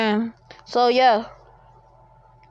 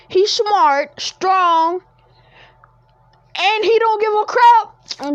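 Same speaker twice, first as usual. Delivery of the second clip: higher and louder hype; hyped; 8 semitones higher, 4 dB louder, slower than usual. Real, not acted. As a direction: build up to a loud rave. Hyping up the character.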